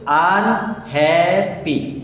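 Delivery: Unhappy very slow, each syllable held apart; broken into syllables. Shown as a demonstration. Teacher shows pupil what a syllable is, unhappy slowly broken up. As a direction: break unhappy into three long, separate syllables. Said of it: "দেখো এখানে ভেঙে ভেঙে যাচ্ছে না".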